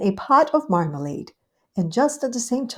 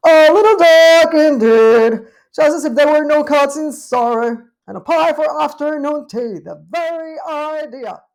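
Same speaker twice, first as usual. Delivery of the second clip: disdainful; 8 semitones higher, 11 dB louder than usual. Acted. Cousin Tabitha sneering at the party, loud and disdainful. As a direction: look down your nose at it. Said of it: "Cousin Tabitha was disdainful".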